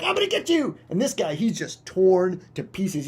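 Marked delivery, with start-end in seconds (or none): 0.0-0.8 high hammed-up predator; cartoon bird threat; menacing bird. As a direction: taunt the prey from above. Bird swoops back.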